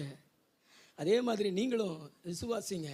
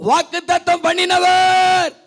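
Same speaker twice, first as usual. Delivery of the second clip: high shout with a long-held last word; as loud as possible; 11 semitones higher, 14 dB louder, slower than usual. Real, not acted. Preacher leading congregation's call and response, as loud as possible. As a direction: shout the call out, stretching its ending. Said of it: "இன்னொரு முறை சொல்லுவோமா?".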